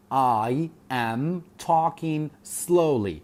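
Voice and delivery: slow, spaced-out English words; talking slowly. Shown as a demonstration. Teacher repeating the adverb example for students, talking slowly. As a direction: say it slowly, pausing between the words. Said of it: "من بە هێواشی قسە دەکەم".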